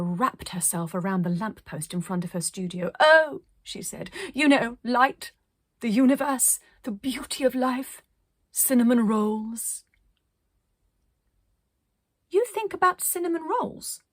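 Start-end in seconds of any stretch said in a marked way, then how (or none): 3.0-3.4 high, loud theatrical oh; grand gasp of oh. Acted. Arcadia to the child, high loud theatrical oh. 4.3-9.8 higher, louder, dreamy and grand; dreamy artist. Acted. Arcadia dreamily lists her thoughts, higher and grand. 12.3-14.0 put-on high, small child's voice asking; a puzzled child, high; little voice. A narrator's reading. The child asks Arcadia in a high small voice. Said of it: "said the little voice".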